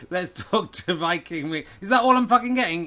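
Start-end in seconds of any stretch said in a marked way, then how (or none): 1.8-2.9 high, fast, indignant; indignant outburst. Acted. Angry me thirty-two complains to the commentator, high and fast. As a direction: complain he got too little airtime.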